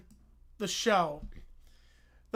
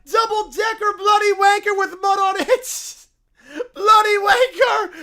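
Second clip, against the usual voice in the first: loud high escalating insults; shouting match; 9 semitones higher, 11 dB louder than usual. Acted. Characters trading shouted insults. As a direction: scream them back and forth, laughing.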